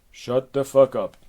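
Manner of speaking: loud, forceful order; angry imperious command; exasperated Hera. Acted. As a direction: silence the nymph loudly. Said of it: "back to Hera".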